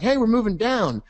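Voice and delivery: high mock voice for price; playful cartoon voice of a chart; voicing price.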